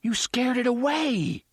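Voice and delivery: put-on high accusing whine of a boy. A narrator's reading. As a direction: blame him sharply, like a hurt kid. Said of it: "Jake accused".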